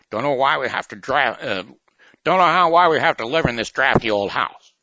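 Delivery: high, loud, fast put-on character line; movie quote voice. Host replaying a film line for the quiz in a high loud voice.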